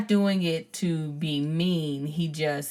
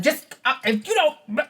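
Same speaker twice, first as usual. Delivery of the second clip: high, louder mock fussing at the kids; 9 semitones higher, 6 dB louder than usual. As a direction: scold the kids, pitched up. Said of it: "I am fussing".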